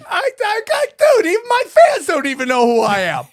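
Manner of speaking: high-pitched, incredulous complaint; exasperated. Real, not acted. Host complaining that even fans don't know him, exasperated. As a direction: wail it in comic disbelief.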